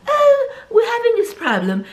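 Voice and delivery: very high, hammed-up posh British voice; Yorkshire pudding accent. Soap character confiding a problem, hammed-up British accent. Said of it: "that Yorkshire pudding accent".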